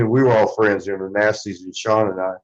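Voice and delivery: ordinary talk, nothing marked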